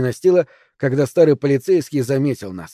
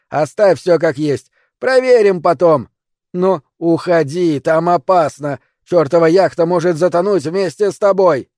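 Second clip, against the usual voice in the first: loud, raised, urgent warning; yelling; 4 semitones higher, 6 dB louder than usual. A narrator's reading. Old man urging girl off the yacht, yelling. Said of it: "заорал Финч".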